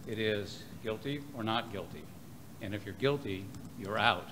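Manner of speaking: ordinary talk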